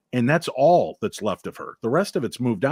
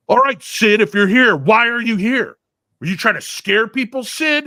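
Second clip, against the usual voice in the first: louder, higher, mocking demand to the ghost; taunting challenge; 10 semitones higher, 8 dB louder than usual. Team members taunting the ghost Sid, loud mocking challenge. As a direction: goad the spirit like a cocky ghost hunter. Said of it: "What attitude is that?".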